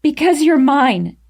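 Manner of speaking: loud, high outburst; yelling back. A narrator's reading. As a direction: blurt it out in a yell. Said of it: "I yelled back at him".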